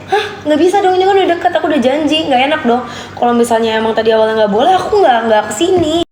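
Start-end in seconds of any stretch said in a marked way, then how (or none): 0.1-2.8 protesting. Retold or imitated. Retold protest to boyfriend.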